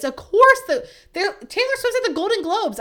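high-pitched incredulous protest; loud, high "of course" outburst; exasperated disbelief. Real, not acted. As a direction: blurt it out high, stumbling, as if it's obvious. Exasperated rant at host's joke.

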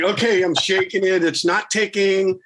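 slow, wary checking of a package; cautious inner voice. Retold or imitated. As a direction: slowly talk yourself through it. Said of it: "I'm like".

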